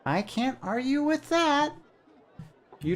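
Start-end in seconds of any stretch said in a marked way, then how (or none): 0.0-1.7 very high, slow, squeaky sprite voice; a tiny bird-sized helper, cheerful; chirpy little creature. Acted. Jan introduces himself to the traveller, high and chirpy.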